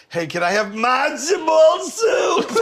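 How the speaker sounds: high, drawn-out hammed-up deli voice; overdone ethnic deli caricature; mock deli customer. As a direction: wail the order slowly, way up high. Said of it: "if I go to Katz's Deli".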